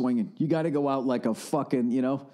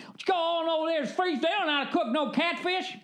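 high, hammed-up Southern yokel; squawky put-on Southern voice, very high; mock Southerner; 14 semitones higher, faster than usual. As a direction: complain like a cartoon Southern local. Acts a Southerner answering back.

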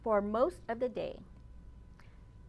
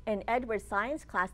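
ordinary delivery

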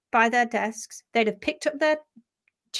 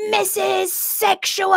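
loud, high, drawn-out yell; rowdy kid bellowing a nickname; shouted taunt; 9 semitones higher, 3 dB louder, slower than usual. Retold or imitated. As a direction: holler it down the school as loud as you can. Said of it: "slung open my door and shouted".

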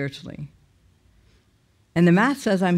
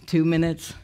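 ordinary talk

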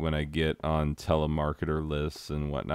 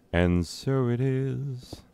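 higher, slow ceremonial pronouncement; solemn intoning; 8 semitones higher, slower than usual. Acted. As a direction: proclaim it like a ritual decree. Repeats phrase solemnly.

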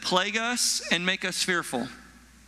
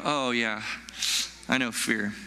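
low, slower admission of fear; sobered recognition; 7 semitones lower than usual. Acted. Preacher voicing a listener who truly knows fear, sobered recognition. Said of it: "when I mention fear that way".

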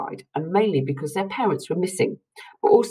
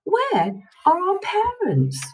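calling out; slower than usual. A narrator's reading. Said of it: "they called".

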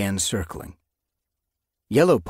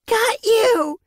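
very high, loud victory cry; triumphant yowl; 9 dB louder than usual. A narrator's reading. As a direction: shout it in triumph. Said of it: "she yowled".

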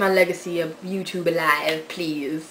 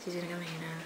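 very soft, low aside; hushed aside; 4 semitones lower, 19 dB quieter, faster than usual. Real, not acted. Daughter confiding to viewers before mother comes in, hushed aside. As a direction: drop it right down, just for the camera.

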